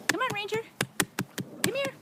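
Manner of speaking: very high coaxing call. Real, not acted. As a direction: sing the pup over to you. Calling puppy.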